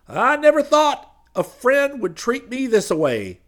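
slow, loud, high whine; complaining preacher. Acted. Preacher thrown into the road complaining, slow and loud. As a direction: whine it out slowly. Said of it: "he complained".

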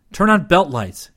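high, loud called order; calling out. A narrator's reading. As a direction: call the order out to the whole crew. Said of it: "he called".

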